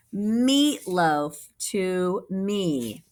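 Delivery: slow, drawn-out reveal; little boy's answer. Acted. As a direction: stretch it out triumphantly. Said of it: "said the little boy".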